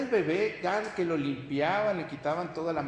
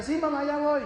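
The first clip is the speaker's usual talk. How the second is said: put-on high, dismissive kid voice; lazy child reply; 10 semitones higher, 4 dB louder than usual. Son brushes off his mother, high lazy kid voice. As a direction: brush off mom like a child who won't come. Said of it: "el hijo te escucha".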